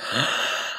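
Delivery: quick unvoiced gasp of awe; sharp gasp. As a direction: gasp like a starstruck fan. Hears star call him friend.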